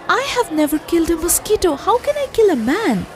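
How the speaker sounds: high, fast, desperate plea; a lightly voiced frightened plea; pleading cry. A narrator's reading. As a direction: beg not to be made to kill. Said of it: "prayed and cried really hard".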